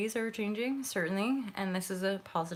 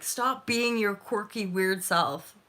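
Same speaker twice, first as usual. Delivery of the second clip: loud, higher-pitched imitated demand; mock scolding; 3 semitones higher, 8 dB louder, slower than usual. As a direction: act out a disapproving date, drawing it out loudly. Acts out a disapproving date's demand.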